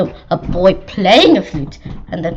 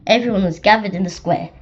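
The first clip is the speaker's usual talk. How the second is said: ordinary delivery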